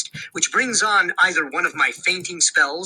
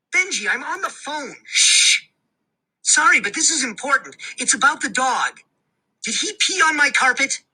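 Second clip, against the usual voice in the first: lightly voiced mom, higher; hushing, distracted mother; busy mom; 7 semitones higher than usual. A narrator's reading. Mom on hold hushing her son, distracted and higher.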